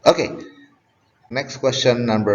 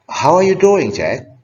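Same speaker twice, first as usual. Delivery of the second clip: cheerful greeting; 11 semitones higher, 5 dB louder than usual. Shown as a demonstration. Teacher acts out a greeting.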